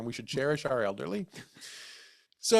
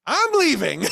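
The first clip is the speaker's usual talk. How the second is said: much higher, loud exasperated announcement; declaring exit; 12 semitones higher, 8 dB louder, slower than usual. Retold or imitated. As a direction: announce you're done. Storming out of the store.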